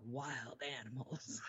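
a hint of a low, soft gravelly ghost voice; gravelly EVP voice. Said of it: "extra gravelly".

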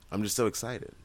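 ordinary delivery

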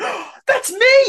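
gasp, then high, loud, drawn-out cry; retold delighted surprise. Star seeing himself on a fan's arm, thrilled. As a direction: imitate someone thrilled to spot himself. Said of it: "he just went".

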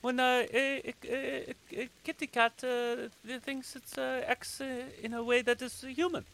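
high, halting character voice; high-pitched, groping for words; rambling Mary. Acted. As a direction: stumble through an explanation in a high voice. Game master voices Mary.

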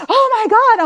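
high, loud mock-shocked exclamation; imitating her own shocked online reaction; shocked outburst. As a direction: shoot the pitch way up in imitated outrage. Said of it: "I'm like".

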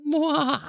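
high smacking air kiss; blowing an air kiss. Acted. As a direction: blow a kiss.

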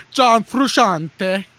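John Frusciante drawn out slowly, loud and high, froo-SHAHN-tay; hammed-up, loud Italian John Frusciante; exaggerated Italian. Shown as a demonstration. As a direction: savor John Frusciante, slow and grand, Italian style. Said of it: "I wanna say it like that from now on".

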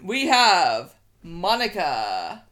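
slow, loud ring announcer call; wrestling commentator. Acted. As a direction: stretch it out for the crowd. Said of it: "wrestling announcer's voice".

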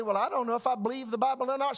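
lightly voiced doubter, high and fast; doubting listener. Preacher voicing a listener unsure of the Bible, lightly mocked doubter. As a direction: rattle it off as a mild mock objection.

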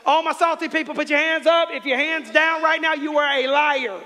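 loud, high preacher's call; full-force preaching. Acted. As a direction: preach it to the whole room.

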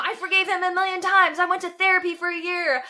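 high-pitched mock outrage; acted-out, offended self-defence; indignant retort. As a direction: protest high and insistently, piling up the proof. Acts out indignant retort to cousin.

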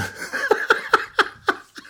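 high wheezing laughing fit; helpless, wheeze-filled laughter from a head cold; wheezy laugh. Real, not acted. Host cracking up at wife's joke, wheezy laugh. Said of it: "my laugh has a lot more wheeze".

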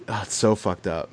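ordinary talk, nothing marked

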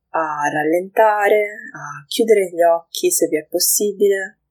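unhurried meditation guiding, long pauses between phrases; guided meditation. Acted. Podcast host leading listeners through a grounding moment, guided meditation. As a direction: guide listeners gently into the breath, leaving space.

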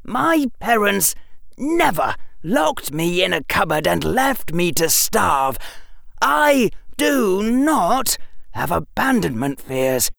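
high, measured indignation; tightly controlled upset, word by word; shaking voice. A narrator's reading. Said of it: "Harry's voice was shaking".

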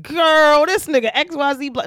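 very high, loud gossip; gossiping to a friend. Retold or imitated. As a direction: spill it to your girl, loud and high. Telling a girlfriend the real story.

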